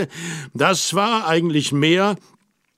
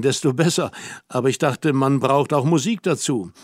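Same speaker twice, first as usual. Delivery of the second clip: ordinary delivery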